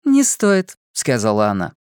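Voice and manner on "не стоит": put-on bubbly sorority girl. A narrator's reading. Fortuna voiced.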